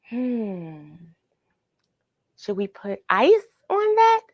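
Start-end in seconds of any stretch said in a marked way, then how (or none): 2.4-4.2 playful high question. Acted.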